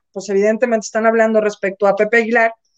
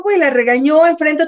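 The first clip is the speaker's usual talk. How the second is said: ordinary delivery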